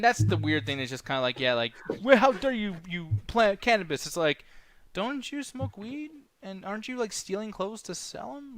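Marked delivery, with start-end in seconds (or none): 2.0-4.1 high indignant gasp of a voice; mocking the mom scolding about weed, high and indignant; mock outraged mom. 4.9-8.6 high, slow disbelieving question; stunned, slow rhetorical jab, pitched up; incredulous. Real, not acted. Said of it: "incredulous rhetorical questions".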